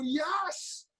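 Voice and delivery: high, soft mock cheer; thin, high celebratory cry; retold wild celebration. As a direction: imitate a crowd going mental, held back in volume. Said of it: "everybody's going mental".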